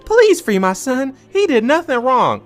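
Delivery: high, slow pleading mother's voice; pleading mom. Retold or imitated. Mother begging police to free her son, high slow plea. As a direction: beg like a desperate mom.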